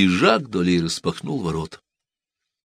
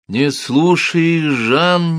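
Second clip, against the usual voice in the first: slow, higher pleading wife; insistent wife; 9 semitones higher, 5 dB louder, much slower than usual. A narrator's reading. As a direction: hold her husband back. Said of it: "не сдавалась женщина".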